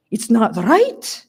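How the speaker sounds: put-on slow, drawn-out Hungarian-accented protest; thick Hungarian accent. A narrator's reading. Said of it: "Hungarian accent".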